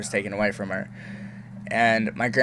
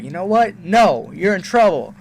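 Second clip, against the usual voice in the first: higher, louder stern mom; mock stern mom; 8 semitones higher, 6 dB louder than usual. Mom tells her son he is in trouble, sternly. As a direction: lay down the punishment.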